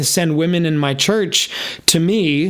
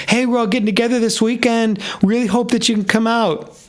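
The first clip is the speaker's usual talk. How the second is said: Sharon's invite; 7 semitones higher than usual. Retold or imitated. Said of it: "same thing".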